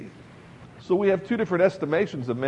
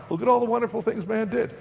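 mock admiration; 8 semitones higher than usual. Mocking the world's boast.

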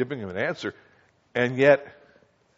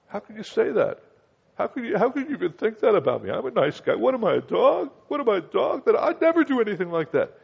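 much higher, half-laughing indignant protest; a mock-hurt "what am I, a dog?"; offended Hazael; 10 semitones higher than usual. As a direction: deny it like a wounded nice guy. Acted Hazael denial.

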